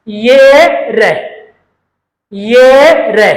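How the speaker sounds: ये र stretched very slowly; slow board pointing. Shown as a demonstration. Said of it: "अब देखो".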